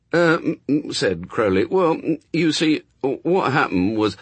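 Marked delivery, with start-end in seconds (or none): 0.1-0.9 high, loud, flustered stammering; nervous stammer. A narrator's reading. Crowley stammers before his master. 1.6-4.2 high, loud, stumbling excuse; flustered, tripping over the first sounds; nervous stammer. A narrator's reading.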